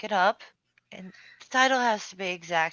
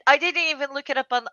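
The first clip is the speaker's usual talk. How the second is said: ordinary delivery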